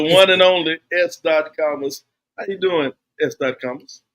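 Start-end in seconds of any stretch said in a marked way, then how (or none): none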